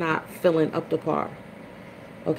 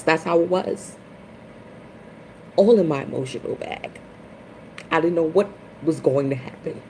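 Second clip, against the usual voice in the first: ordinary delivery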